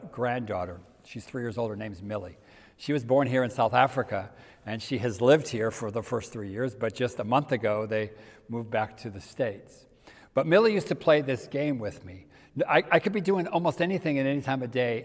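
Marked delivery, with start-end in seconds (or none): none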